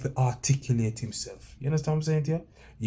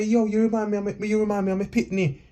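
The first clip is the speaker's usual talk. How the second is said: imitating the guest; 7 semitones higher than usual. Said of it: "the guest had to say".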